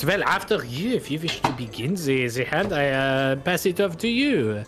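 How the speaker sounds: put-on lightly French-accented gambler, polite and amused; a suave foreign card sharp offering a game; Bretonnian accent. Gambler invites the players to deal, light Bretonnian accent.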